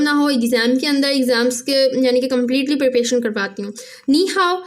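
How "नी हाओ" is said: नी हाओ spoken slowly and clearly as a teacher's model; teacher modelling नी हाओ for new students; Chinese greeting. Shown as a demonstration. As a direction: say नी हाओ slowly, each syllable distinct. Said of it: "मतलब hello".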